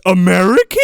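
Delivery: mock thrilled yell; loud excited question. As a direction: shout it with delight. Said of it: "She goes".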